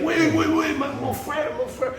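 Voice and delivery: very high, urgent calling voice; guitarist pleading. Retold or imitated. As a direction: stop him like a brother, voice way up. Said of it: "And he's like".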